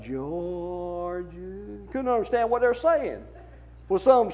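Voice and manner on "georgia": soft, slurred Southern "Georgia" said like "Jawja"; mock Southern kids' diction; mumbled southern Georgia. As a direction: swallow "Georgia" in a thick drawl of children singing. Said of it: "they'd all sing".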